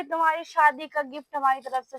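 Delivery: ordinary talk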